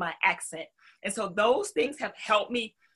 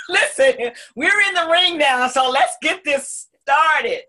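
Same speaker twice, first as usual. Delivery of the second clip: loud, laughing ring announcer call; hype announcer; 5 semitones higher, 7 dB louder than usual. Real, not acted. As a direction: pump it up like a fight intro. Boxing ring hype opener.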